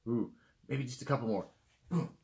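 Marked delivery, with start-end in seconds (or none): none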